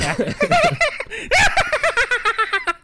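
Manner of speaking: high maniacal cackle, loud; shrill crazed villain laugh; Joker laugh. Pretend. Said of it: "That's a good Joker laugh".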